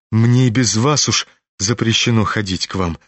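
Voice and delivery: ordinary delivery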